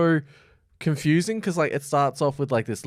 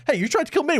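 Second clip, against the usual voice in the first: mock indignant beast; faster than usual. Beast retorts to king.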